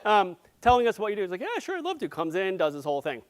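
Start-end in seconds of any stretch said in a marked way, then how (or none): 1.4-2.1 eager acceptance. Retold or imitated. Acts friend accepting an invitation.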